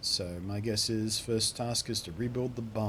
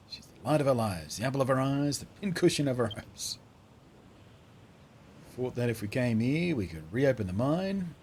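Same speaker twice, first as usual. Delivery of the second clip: put-on accented miner voice, pleading his case; put-on miner, exasperated then devoted; earnest miner character. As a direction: argue with a mate in rustic character.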